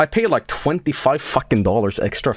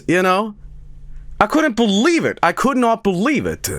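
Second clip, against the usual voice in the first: incredulous rant; 9 semitones higher than usual. Real, not acted. Mocking an odd antivirus ad, he rants in high incredulity. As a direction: pitch shoots up in outraged disbelief. Ranting about a strange commercial.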